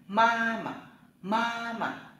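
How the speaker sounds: ma mà said high, the second syllable rising; Chinese tone drill. Shown as a demonstration. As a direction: model ma mà slowly for learners. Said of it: "Chúng ta đọc lại".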